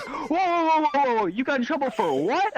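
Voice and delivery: high, stretched-out mock astonishment; hammed-up alarmed double take; shocked disbelief. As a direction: imitate a startled school official, pitch jumping up. Startled AP reacts.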